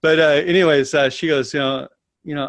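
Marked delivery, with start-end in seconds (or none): none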